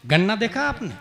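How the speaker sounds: normal talking voice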